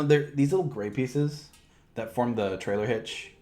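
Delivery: ordinary talk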